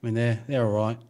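rambling Aussie bush talk; Australian accent. Shown as a demonstration. Player improvising outback small talk for friends, Australian accent. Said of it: "like to talk about Australian".

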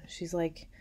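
ordinary delivery

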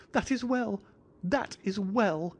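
fussy, jittery host bowing and scraping; thin high voice. A narrator's reading. Little man greeting Miss Morstan in a thin high voice. Sholto speaks.

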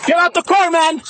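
high yelled order; shouting. Real, not acted. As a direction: yell it up high at him. Officer yelling at driver.

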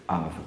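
"Of" said low and soft, ending in V. Shown as a demonstration. Teacher modelling "Of" after spelling it A-V, low and soft. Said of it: "um A e um V".

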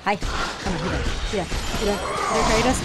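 ordinary talk, nothing marked

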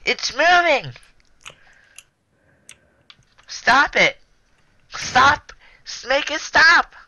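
high panicked yelling; panicked shriek. Real, not acted. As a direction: scream it in a panic. Panicked yelling as cart moves.

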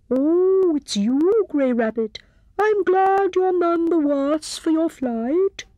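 put-on high, slow hooting old owl voice; a kindly owl greeting a friend; wise old owl. A narrator's reading. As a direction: owl pleased to see the rabbit.

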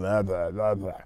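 loose, lazy blah blah with a flopping tongue; floppy tongue babble. Shown as a demonstration. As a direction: flap the tongue limply. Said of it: "It's not flopping around".

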